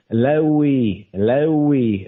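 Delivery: Lewi said as LEH-wee, stress on the first syllable; Hebrew name Lewi. Shown as a demonstration. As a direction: give the Hebrew form Lewi, LEH-wee, falling at the end. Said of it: "Levi means joined to".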